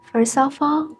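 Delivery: unhurried meditation guide with long pauses; spacious, measured mindfulness voice; guided meditation. Acted. Leads a short mindfulness meditation.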